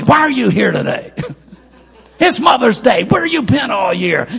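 loud, high scolding of a latecomer; angry preacher in the lobby. Retold or imitated. Preacher in the lobby scolding a Mother's Day visitor, loud and high. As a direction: snap at a churchgoer who only comes on Mother's Day. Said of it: "a preacher can't be angry all the time".

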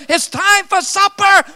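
high supper holler; preacher's high-pitched calling cry. Retold or imitated. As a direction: call it out high, like a mother summoning kids. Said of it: "calls out your name".

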